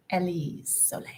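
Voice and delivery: soft, whispered name; whispery, drawn-back greeting; special name delivery. Real, not acted. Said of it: "I have to say that like that".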